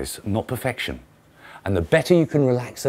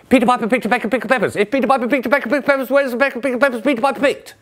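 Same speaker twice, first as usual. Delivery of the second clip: rapid, higher tongue twister; fast tongue twister; 14 semitones higher, much faster than usual. Shown as a demonstration. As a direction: rattle it off fast and high. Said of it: "Find some tongue twisters".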